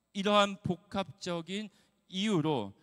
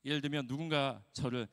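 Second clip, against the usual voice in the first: normal talking voice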